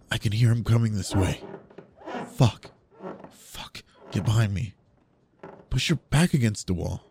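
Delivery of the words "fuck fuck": whispering while hiding. Acted. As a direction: breathe it out barely voiced. Hiding in closet.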